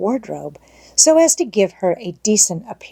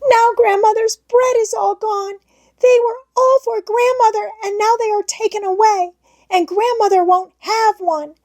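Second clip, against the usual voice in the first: put-on high, sobbing child's voice; a child weeping over Grandmother's rolls. A narrator's reading. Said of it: "sobbing out at intervals".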